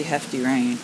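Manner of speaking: usual speaking voice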